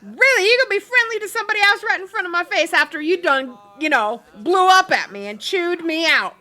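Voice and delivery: high, loud, fast indignant rant; mock jealous outburst. Girlfriend jealously scolding her boyfriend, mock outrage. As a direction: rattle it off, hammed-up jealousy. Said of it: "looking over there going".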